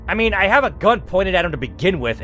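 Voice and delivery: ordinary delivery, nothing marked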